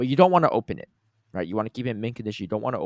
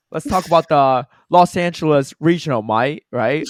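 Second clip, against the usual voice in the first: mock British; 6 semitones higher, 10 dB louder than usual. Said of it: "I speak in a British accent".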